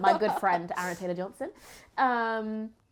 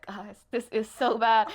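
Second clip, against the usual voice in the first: retold exasperated remark; 3 semitones higher, 7 dB louder than usual. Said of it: "I said".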